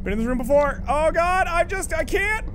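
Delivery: rising high whine of dread; panicked. Real, not acted. Panic at a scare in game.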